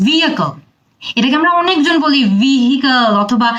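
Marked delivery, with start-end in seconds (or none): none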